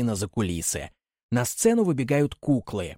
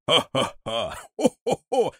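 hearty villain laugh; guffaw; 2 semitones higher, slower than usual. Acted. Karabas laughing at Buratino. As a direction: roar with laughter. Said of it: "хохочет".

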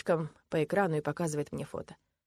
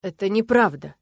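louder, indignant outburst; exploding in denial; 3 semitones higher, 9 dB louder than usual. A narrator's reading. Man denying the girl's accusation, indignant. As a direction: burst out defensively. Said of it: "взрываюсь я".

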